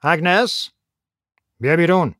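understated shout to Agnes. A narrator's reading. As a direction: call her out from the next room. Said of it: "فریاد زد".

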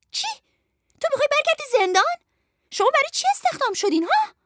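very high, fast, nasty scolding; high-pitched wicked voice. Acted. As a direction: berate underlings as a mean villainess. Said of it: "از صدای زیر و بدجنسش شناخت". (صدای زیر و بدجنس)

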